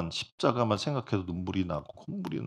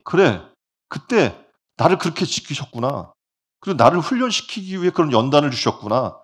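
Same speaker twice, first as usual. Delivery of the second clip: grateful realization; 5 semitones higher, 8 dB louder than usual. Retold or imitated. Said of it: "하나님의 은혜를 생각할 때 감격이 넘치는".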